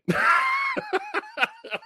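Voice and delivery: high shouted burst of laughter. Real, not acted. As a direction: let out a big fed-up laugh. Laughs at own annoyance.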